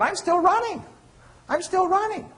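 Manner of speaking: high, loud, strained runner voice; breathless mid-run cry; panting runner. Pretend. Lecturer acting out running hard.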